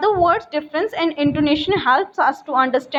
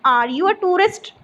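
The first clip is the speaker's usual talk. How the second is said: yes-no question lifting on "tourist"; raised question tune up to "tourist"; rising intonation; 4 semitones higher than usual. Shown as a demonstration. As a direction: ask it with the pitch climbing at "tourist". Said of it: "has a rising intonation".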